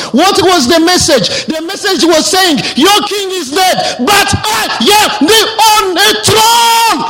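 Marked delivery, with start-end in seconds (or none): 2.7-7.0 voice of God. Retold or imitated. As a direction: proclaim it like the King on the throne. God's voice in the vision.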